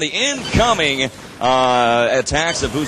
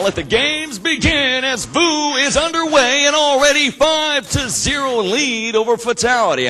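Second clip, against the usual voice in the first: excited shout; 10 semitones higher, 3 dB louder than usual. Real, not acted. Game starts, caster erupts.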